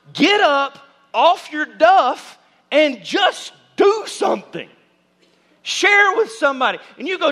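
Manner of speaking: high, loud, driving sermon delivery; preaching at full force. Real, not acted. Pastor urging his church to go serve others, preaching hard. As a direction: push the congregation to act, full volume.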